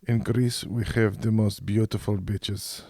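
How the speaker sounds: put-on slow, thick Greek-accented English. Bible teacher addressing his class in Austria, thick Greek accent. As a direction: draw it out in a heavy Greek accent. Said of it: "he had a thick accent".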